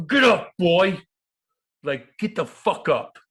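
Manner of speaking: high, loud barked order; retold yell. Guest re-enacts yelling at a passing player. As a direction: yell at him to get moving. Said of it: "I fucking yelled at Patty".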